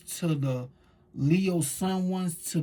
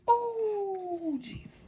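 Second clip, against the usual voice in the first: high swoon; 4 dB quieter, much slower than usual. Real, not acted. Swooning exclamation.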